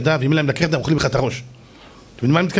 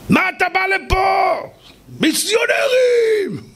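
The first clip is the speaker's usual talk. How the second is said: high-pitched acted shout of an outraged father; צעקה של אב זועם; slower than usual. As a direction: yell it far above your usual pitch, stretching the last word. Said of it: "והוא צועק". (צעקת האשמה זועמת בקול גבוה מאוד)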